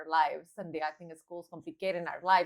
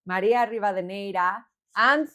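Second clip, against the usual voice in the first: grand show opener; announcer intro; 5 semitones higher, 11 dB louder than usual. Acted. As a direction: sell the show title like a radio host.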